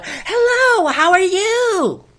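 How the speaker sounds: high, slow, sing-song teller greeting; programmed bank teller. Retold or imitated. Bank teller greeting a customer, high sing-song chirp. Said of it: "like Wells Fargo, they go".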